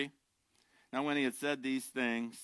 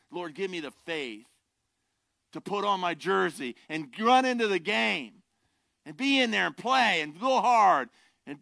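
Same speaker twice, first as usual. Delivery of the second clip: loud, high, driving sermon voice; full-force pulpit preaching; fervent preaching; 9 semitones higher, 5 dB louder than usual. Acted. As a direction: preach it with rising fire.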